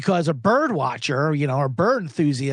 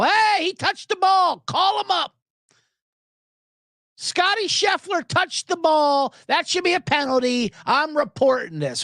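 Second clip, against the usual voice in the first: busybody fan caller; 13 semitones higher than usual. Acted. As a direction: play a fussy fan reporting a foul, pitched up high. Golf fan phoning in a foul.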